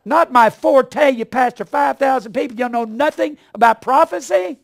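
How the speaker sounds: furious shout at a preacher on TV; indignant yell. Retold or imitated. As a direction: yell in disbelief at the TV. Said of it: "I yelled at the s-, T V".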